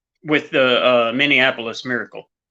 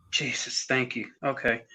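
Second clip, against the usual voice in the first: soft, spent exhale of relief; hushed, worn-out relief; relieved sigh of thanks; 8 dB quieter, faster than usual. Retold or imitated. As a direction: say it quietly, letting the tension go. Acts out relief at game's end.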